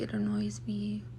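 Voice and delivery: breathy whisper, voice dropped right down. Retold or imitated. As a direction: hiss it under your breath, annoyed. Quoted retort to nosy strangers.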